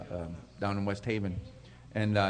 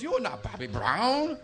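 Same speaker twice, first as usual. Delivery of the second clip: slow, higher retold disbelief, rising at the end; disbelieving; 5 semitones higher, 4 dB quieter, slower than usual. Cook doubts the stranger is [name], mock disbelief. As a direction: imitate a sceptic stretching it out.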